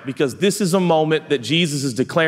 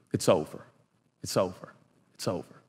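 each repeat softer and lower, dying away; fading to a hush; 8 semitones lower, 10 dB quieter than usual. Acted. Preacher letting the bad news sink in, fading to a hush. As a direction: let it fade out like a last breath.

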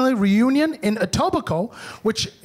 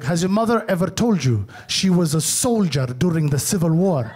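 lower, heavier uncle voice; imitating uncle; 6 semitones lower than usual. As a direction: uncle cornering his nephew at a reunion, low and heavy. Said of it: "He's like".

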